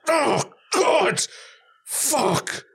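high, fed-up exclamation; exasperated groan. Retold or imitated. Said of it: "the whole airport was just going like".

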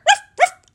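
loud high yapping woof woof; dog barks. Acted. Toby barks at the teddy bear, loud and high. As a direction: bark like an excited little dog. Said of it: "barked Toby".

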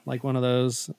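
ordinary talk

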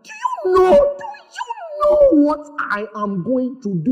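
high, drawn-out mock self-important voice; hammed-up, slow, high-pitched wail of a question; mimicking problem magnifiers. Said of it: "It's like they want you to know that".

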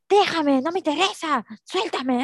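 put-on high, indignant kid voice; bratty child protest. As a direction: whine it like a defiant little girl. Acting out a bratty niece.

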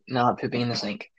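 Not said softly, muttered under the breath; muttered repeat. Real, not acted. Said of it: "Your fantasies of pooping in the sink".

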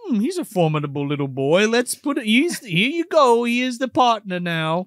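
a hint of a stern old butler; Alfred impression. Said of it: "Alfred's like".

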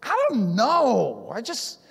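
exasperated, slow, drawn-out retort; acted anger. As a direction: act out being mad without knowing why. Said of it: "what are you mad about".

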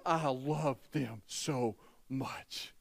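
slow, spaced words ending on a softened "much"; extra emphasis. Real, not acted. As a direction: weigh "love" and "so", pause before "much". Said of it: "give a little extra emphasis to it".

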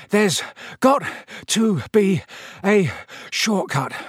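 out of breath, gasped word by word; panting. A narrator's reading. Ron climbing the seventh staircase, panting. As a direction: heave a breath between words, starting high. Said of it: "Ron panted".

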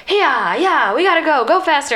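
high, hammed-up race cheering; acted rallying yell; excited urging. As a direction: urge them on like a racing crew. Voicing prisoners racing each other.

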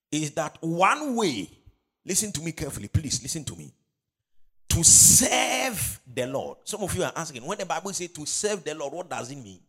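high, urgent preacher's delivery; full-force preaching. Real, not acted. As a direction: drive the point home to the congregation. Preaches main point at full force.

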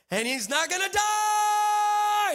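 high, loud, slow drawn-out shout; child yelling. Retold or imitated. Son repeats the prophecy to the waiter, a high slow shout. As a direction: bellow it so the whole room hears. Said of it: "And he yells it again".

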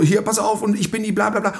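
high, pushy mock show-off; lightly voiced attention-seeker; imitating the attention-grabbing sister, pitched high; self-important sister. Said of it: "weil sie halt sie dann so".

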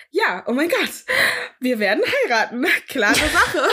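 hammed-up infatuated certainty; mock overexcitement. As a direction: gush like a smitten teenager, then laugh. Acts out her overeager inner reply.

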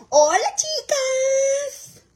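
high, slow drawn-out hello; excited greeting. Real, not acted. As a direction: sing out the greeting to fans. Vlog greeting to followers.

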